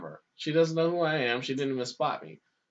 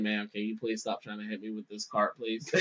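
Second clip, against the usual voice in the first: softer, lower, patient request; calm, polite plea; 7 semitones lower, 8 dB quieter than usual. Retold or imitated. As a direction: ask her nicely, keeping it low. Guard calmly asking lady to stop.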